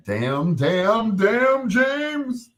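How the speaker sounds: rising high wail of the name; Florida Evans breakdown. Retold or imitated. Florida Evans finally breaking down, high wail. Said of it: "what I call the Florida Evans".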